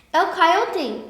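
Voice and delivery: high, loud startled cry; the ant spots the coyote, calls out; loud surprised exclamation; exclaiming. A narrator's reading. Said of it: "exclaimed the little red ant".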